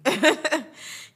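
a loud real laugh breaking out; bright burst of laughter. Real, not acted. Laughing at her own story.

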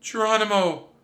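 high, loud urgent call. Acted. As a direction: call out a warning. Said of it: "she called".

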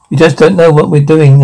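ordinary talk

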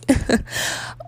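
quick breathy laugh; short laugh. Real, not acted. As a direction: laugh it off lightly. Laughs at own crack.

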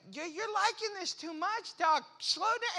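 high-pitched comic pleading; mock-distressed patient complaint; pained protest. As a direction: beg him off in a strained-high pitch. Patient begs doctor to ease off.

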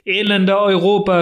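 frantic outcry. Acted. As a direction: call out her own name as if driven by furies. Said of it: "Wie von Furien bestürmt sprang die verlassene Jungfrau auf".

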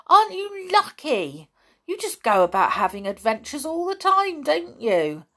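high, loud, envious wonder; thrilled amazement. A narrator's reading. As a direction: gush like an excited boy. Boy thrilled by the adventures.

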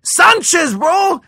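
high shouted heckle; heckling shout. Retold or imitated. Comic imitating drunk hecklers shouting his name. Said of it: "they start heckling me".

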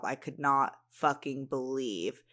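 ordinary delivery

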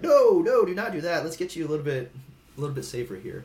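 high, loud alarmed "no, no" easing off; friends warning. Retold or imitated. Friends stopping him from a risky move, alarmed and loud. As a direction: friends yanking him back from a bad idea. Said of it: "bumpers for me".

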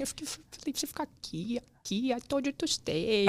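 high, hushed mock scolding; imitating the scolding man. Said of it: "Falou desse jeito".

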